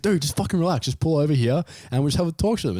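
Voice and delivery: high, brisk voiced mate; mate calming. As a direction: hurry him to pull over. Mate telling him to relax.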